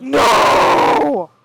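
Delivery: shocked no. Real, not acted. Gamer hearing bad news about Anastasia, gasping loud no. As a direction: gasp then a loud, high shocked no.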